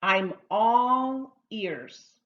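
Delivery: slow, drawn-out teacher demonstration; slow model phrase. Shown as a demonstration. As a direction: stretch each word out for learners to repeat. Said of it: "Why don't you practice that one with me?".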